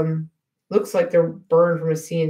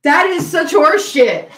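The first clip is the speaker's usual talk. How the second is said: mock-outraged protest; 10 semitones higher, 6 dB louder than usual. Real, not acted. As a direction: protest loudly through a laugh. Protests a lame joke.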